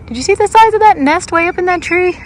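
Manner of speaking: high and loud, amazed at the nest; excited. Real, not acted. Vlogger amazed by a huge bird nest, excited exclaim. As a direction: burst out in wonder, way up high.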